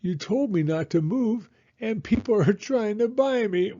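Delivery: put-on high, slowed child's complaint; upset little girl. A narrator's reading. Little girl scolding her father from the shelf, high and upset. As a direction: blame dad, near tears. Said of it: "Taya said".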